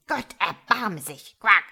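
invoke heaven in exasperation; appalled frog. A narrator's reading. Frog mother despairs at her tadpole, appalled.

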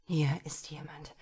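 unvoiced hushed whisper. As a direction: breathe it in awe. Whispered realisation of presence.

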